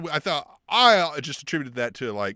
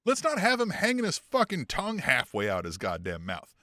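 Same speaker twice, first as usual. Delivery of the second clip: ordinary talk, nothing marked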